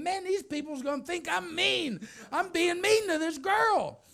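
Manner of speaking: high, loud, hammed-up alarm; comic high-pitched outburst; mock panic. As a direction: fret loudly as if everyone is judging you. Acted alarm at onlookers watching.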